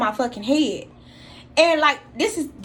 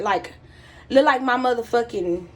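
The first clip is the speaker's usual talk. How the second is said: ordinary talk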